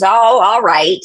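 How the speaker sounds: very loud granny impression; big, loud imitated grandmother; grandmother's way. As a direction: belt it out as an offhand old granny. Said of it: "you know, her way".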